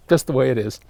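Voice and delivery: usual speaking voice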